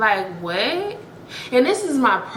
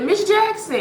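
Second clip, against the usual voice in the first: quoting the clip; 10 semitones higher than usual. Retold or imitated. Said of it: "y'all know that one clip".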